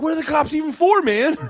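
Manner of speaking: high, outraged complaining call; raccoons yelling. Retold or imitated. Raccoons yelling about cops, high-pitched. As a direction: yell it up high in disbelief. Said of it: "They're all yelling".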